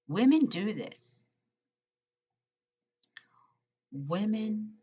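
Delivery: women do this punched high and loud; a strong emphatic jab on women; stress on women. Real, not acted. Emphatic point about women.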